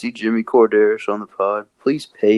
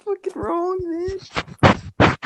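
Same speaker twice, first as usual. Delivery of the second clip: silly high voice; 5 dB quieter, much slower than usual. Pretend. Sudden put-on voice.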